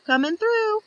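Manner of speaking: a busy bee bustling by; bee pushing past. Acted. Bee pushes into the hive, high and brisk.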